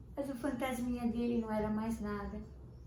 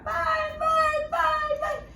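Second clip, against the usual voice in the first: put-on high, loud child screaming for mum and dad; a terrified cry through the house; screaming for parents; 8 dB louder, much slower than usual. Boy in bed screams for his parents, terrified. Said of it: "ele começou a gritar".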